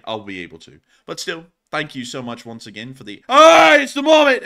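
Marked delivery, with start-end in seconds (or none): 3.3-4.5 sudden loud, high yelp; surprised shout. Real, not acted. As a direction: blurt it out, startled. Sudden mascot appearance mid-thanks.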